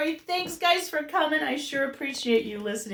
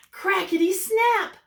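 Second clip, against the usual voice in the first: usual speaking voice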